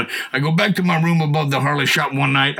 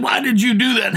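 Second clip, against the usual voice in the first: raised, higher startled shout; retold shout; 9 semitones higher than usual. Confronting the church lady who hit him, raised startled shout.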